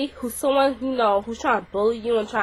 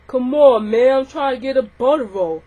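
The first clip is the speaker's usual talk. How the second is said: put-on louder, dopey boy voice; mocking whiny boy; 2 semitones higher, 6 dB louder than usual. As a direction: ham up the boy begging for his roll. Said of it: "here's what he said".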